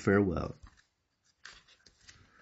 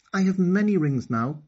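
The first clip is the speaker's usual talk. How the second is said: put-on high, louder girl's voice with a smile; girl tells her friend, high and smiling; smiling Okani; 10 semitones higher, 4 dB louder than usual. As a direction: say it happily. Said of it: "said Okani, smiling".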